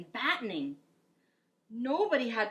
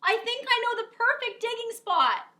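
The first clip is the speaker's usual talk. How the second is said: shouting over wind; 9 dB louder than usual. Acted.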